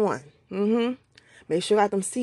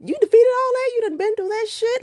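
amazed self-talk; 12 semitones higher than usual. Retold or imitated. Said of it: "sometimes I think about".

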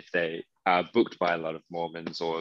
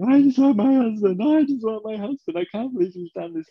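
much higher and louder, whiny; mock crying wail; 7 dB louder than usual. Mocking Lisa crying about her husband, high whiny wail. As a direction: parody a tearful wail. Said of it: "starts crying and then starts going".

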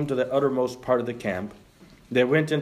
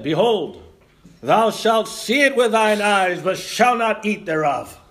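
slow, raised, proclaiming prophet voice; booming prophet; 6 semitones higher, 3 dB louder, slower than usual. Pretend. Preacher acting Elisha for the congregation, slow proclaiming voice. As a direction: declaim it slowly like an Old Testament prophet. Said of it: "And he said".

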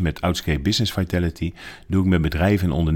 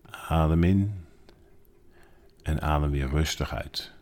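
guided meditation. Acted. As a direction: guide them gently into stillness. (rustige, zachte meditatiestem)

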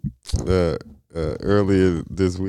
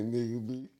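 normal talking voice

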